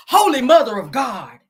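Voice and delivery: hammed-up genteel gasp.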